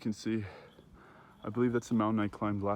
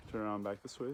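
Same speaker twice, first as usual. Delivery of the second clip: ordinary talk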